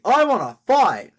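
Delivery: high, loud, put-on Goku voice; over-the-top Goku begging for a fight. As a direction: shout it like a battle-hungry cartoon hero. Third Goku quote, voice changed.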